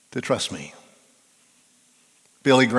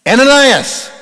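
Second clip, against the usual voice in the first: much higher, much louder divine call; booming call of God; 12 semitones higher, 13 dB louder than usual. Acted. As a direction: boom the name like God from heaven. God calling the name again.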